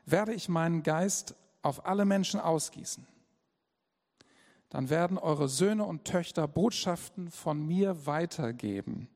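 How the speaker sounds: preacher reads Acts aloud to the church, loud and solemn; solemn scripture reading. Acted.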